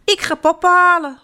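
high-pitched urgent call. A narrator's reading. Janneke calling to stranded Jip, calling out. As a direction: pipe up loudly as a child. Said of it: "roept Janneke".

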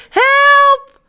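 high, loud, drawn-out cry; crying for help. A narrator's reading. Jip stuck up the apple tree, crying for help. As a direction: yell it out in alarm. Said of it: "roept hij".

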